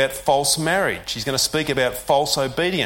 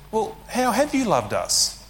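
higher, slow, sceptical question; cynical response; 7 semitones higher than usual. Retold or imitated. Israel doubting God's love, cynical. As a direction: drip with doubt. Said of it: "a hint of cynicism".

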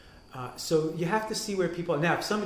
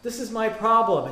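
higher, louder hammed-up caller; mock phone prayer; 8 semitones higher, 9 dB louder than usual. Praying person phoning God with a problem, hammed-up loud voice. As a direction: play an eager caller dialing up God.

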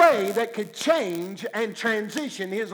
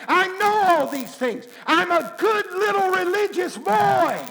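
louder and higher until he is shouting; rising protest; 5 semitones higher, 4 dB louder than usual. Acted. Peter protesting to the Lord, building to a shout. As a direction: protest harder with each I know.

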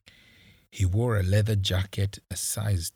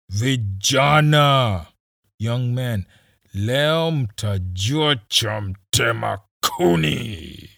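loud, slow threat from a cop; menacing squad leader; 3 semitones higher, 8 dB louder, slower than usual. Acted. Squad leader Kisii threatening the captured boys, slow and loud. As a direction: draw each word out, savouring the threat.

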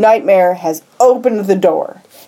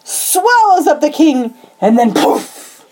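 slow dramatic build, fading to whisper; animated storytelling; 6 semitones higher, slower than usual. Real, not acted. Telling how the shadow takes the king, animated narration. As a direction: draw it out high, then vanish into breath. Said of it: "She's getting more anim".

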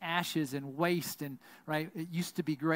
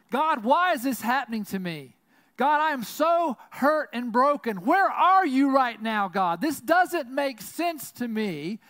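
louder, higher, pleading out to God; anguished cry to God; 7 semitones higher, 8 dB louder than usual. Acted. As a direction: cry out hurt and broken, slowing on the last lines. Voicing an anguished lament prayer.

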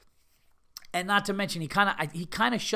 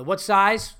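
louder, abrupt interrupting question; mimicked pushy counter guy; quick interruption; 3 semitones higher, 7 dB louder than usual. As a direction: cut in fast, impatient for the order. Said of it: "Real quick, interrupts".

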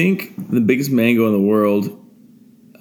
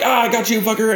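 mock battle cry; 12 semitones higher than usual. Man retelling his cockroach attack, high mock war cry. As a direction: crow it high, like pouncing on prey.

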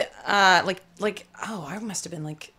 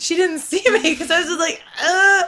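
mock sob; 10 semitones higher, 7 dB louder than usual.

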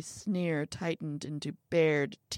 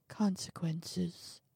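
whispered warning; 7 dB quieter, slower than usual. Acted. Little man repeats the warning.

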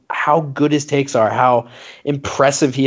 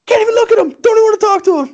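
put-on baby voice; 4 dB louder, much faster than usual. S1 imitating baby Stephen A.

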